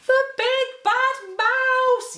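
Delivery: high, slow, loud frightened cry; terrified yell. Acted. The Gruffalo's child seeing the giant shadow, terrified yell. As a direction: shriek it out in fear. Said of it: "yelled the Gruffalo's child".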